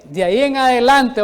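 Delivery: "De ahí en adelante" loudly underlined for the listeners; emphatic key phrase. Real, not acted. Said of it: "oiga bien esta palabra".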